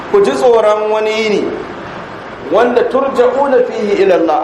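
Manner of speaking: usual speaking voice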